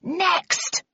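high, strained far-off yell. A narrator's reading. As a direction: yell it high from across the room. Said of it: "yelled Shithouse".

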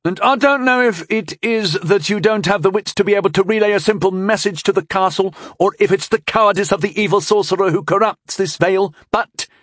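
high-pitched, indignant tirade; sputtering indignation. A narrator's reading. Young knight berates Vren over stupidity, sputtering indignantly. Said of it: "He was sputtering indignantly at Vren".

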